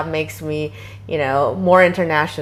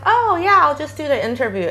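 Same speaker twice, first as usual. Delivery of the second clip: high, quick, offhand "oh yeah"; breezy self-quote; 10 semitones higher, faster than usual. Retold or imitated. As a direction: toss it off lightly, no big deal. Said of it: "I was also like".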